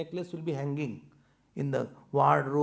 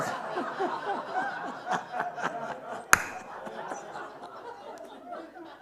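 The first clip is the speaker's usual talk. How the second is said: high, breathy laughter; long laugh; 3 dB quieter than usual. Real, not acted.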